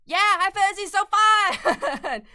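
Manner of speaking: high, loud, slow gushing delight; slow, squealing fangirl excitement; excited gush. Real, not acted. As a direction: squeal it out with real joy. Gushing reaction to chat.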